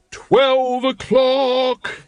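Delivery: high, loud, very slow town crier bellow. A narrator's reading. Carrot bellowing the watchman's call across the street. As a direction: belt the hour out across the street. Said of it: "he bellowed".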